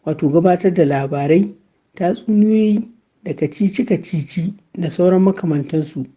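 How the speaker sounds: usual speaking voice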